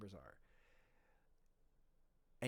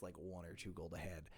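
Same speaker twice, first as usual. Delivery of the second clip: ordinary talk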